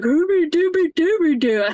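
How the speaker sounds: high, goofy singsong of a clowning dad; silly father singsong. Retold or imitated. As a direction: sing it out like a dad playing the fool. Father teasing sobbing daughter.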